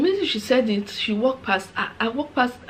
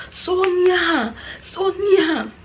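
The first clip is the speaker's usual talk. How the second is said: angry Nigerian woman; 9 semitones higher, much slower than usual. Retold or imitated. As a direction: shout the name like an outraged Nigerian elder. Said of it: "I'm gonna behave like a Nigerian woman".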